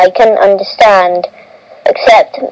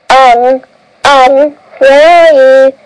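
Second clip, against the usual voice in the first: calling out a name, raised; yelling; 4 semitones higher, slower than usual. Acted. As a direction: shout across the distance. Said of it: "I yelled".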